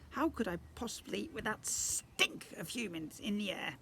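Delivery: a hint of the high whiny prince; lightly squeaky goblin sulk. Goblin prince refuses the feast, lightly whiny. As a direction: whine about the smell.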